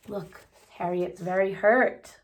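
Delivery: ordinary delivery, nothing marked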